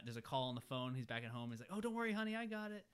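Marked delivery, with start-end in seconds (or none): 1.7-2.8 lightly voiced husband, pitched high; a high, singsong mock reassurance; breezy retold promise to a wife; imitated reassuring husband.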